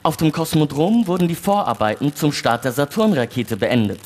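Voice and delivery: formal, even newsreader delivery; news read. Acted. Houston reading the evening news to the moon crew, formal news read. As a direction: read the bulletin like a radio news anchor.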